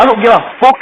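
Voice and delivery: high, loud retold defiance; defiant yell. Raver yelling at old lady, hammed-up defiance. Retold confrontation in bank queue.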